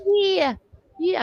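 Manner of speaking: mock the sister's Staten Island accent; drawn-out dismissive Staten Island yeah. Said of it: "all I have to do is imitate her".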